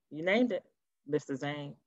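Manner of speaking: usual speaking voice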